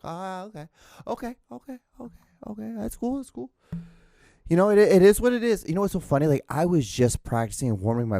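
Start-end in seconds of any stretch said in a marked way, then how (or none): 0.0-3.5 high, soft voice of easy acceptance; mock gullible agreement. Podcaster mimics Ozempic takers agreeing, high soft mock voice. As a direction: go high and quiet, mimicking someone easily convinced.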